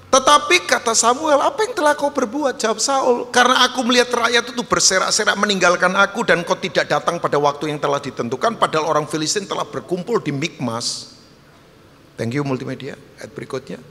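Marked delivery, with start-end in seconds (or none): 0.1-11.1 forceful scripture reading. Real, not acted. Preacher reads Saul's answer to the congregation, loud declamation. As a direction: declaim the verse at full voice.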